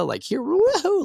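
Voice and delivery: high, hammed-up woohoo, drawn out slowly; playful self-imitated whoop; mock cheer. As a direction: cheer like showing off a trophy. Showing the doctor his sleep graphs.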